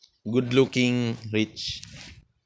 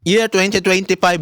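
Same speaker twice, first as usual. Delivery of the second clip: excited shout; 7 semitones higher, 6 dB louder than usual. Real, not acted. Proud declaration to rivals.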